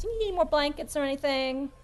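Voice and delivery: high, sweet offer; cooing, high character voice; motherly concern. Acted. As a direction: fuss over a chilly guest. Skinny offers blankets.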